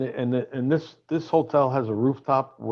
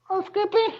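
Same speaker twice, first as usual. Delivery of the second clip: mock housekeeper voice. Comic teasing the rock star as a falsetto housekeeper. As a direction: ham up a high-pitched hotel maid. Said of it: "I'll be like this".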